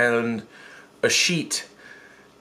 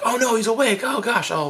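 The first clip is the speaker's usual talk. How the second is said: mock panic; 10 semitones higher than usual. Pranksters caught by waking victim.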